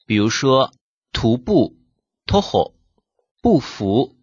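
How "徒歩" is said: 徒歩 read as Japanese "toho", with the 歩 read "ho". Shown as a demonstration. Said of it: "一般读作ほ".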